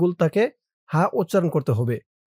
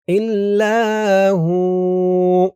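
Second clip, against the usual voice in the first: very slow chant, higher and louder; tajweed recitation; 4 semitones higher, 5 dB louder, much slower than usual. Shown as a demonstration. Teacher showing where to stretch each sound. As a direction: draw out ইলাহা and ইল্লা, stop on হু.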